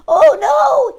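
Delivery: very high, loud gasping cry; a hammed-up gasp of alarm. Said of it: "he gasped".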